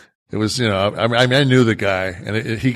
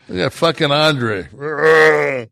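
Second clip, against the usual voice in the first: ordinary talk, nothing marked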